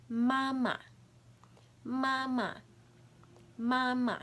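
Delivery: "Mama" with a light staccato second "ma"; high falling "mama", second syllable clipped. Shown as a demonstration. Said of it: "Same concept for number four".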